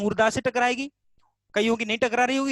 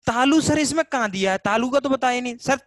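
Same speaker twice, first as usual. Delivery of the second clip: higher, louder student's interjection; objecting student; 3 semitones higher, 5 dB louder than usual. Acted. Student interrupts sir about the missing palate, objecting. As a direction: pipe up loudly as a puzzled student.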